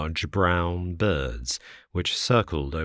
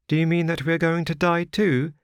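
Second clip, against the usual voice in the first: put-on high, childlike girl's voice; little girl; 12 semitones higher, 4 dB louder, faster than usual. A narrator's reading. Little girl asks the wanderer if they will die, high childlike voice. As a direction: play a small frightened child asking.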